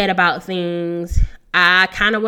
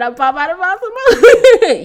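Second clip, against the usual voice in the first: hyped rapper line, higher, louder and laughing; 11 semitones higher, 12 dB louder than usual. Retold or imitated. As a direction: bursts into the lyric with a laugh. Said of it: "What [name] said".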